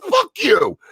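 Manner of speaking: high-pitched, exasperated retort; mock indignant comeback. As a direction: throw it back in comic outrage. Retelling his angry comeback.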